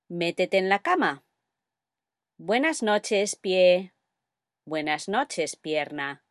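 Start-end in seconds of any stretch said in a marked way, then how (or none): none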